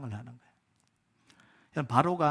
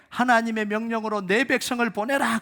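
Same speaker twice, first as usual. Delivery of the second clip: Moses' command; 5 semitones higher, 4 dB louder than usual. Acted.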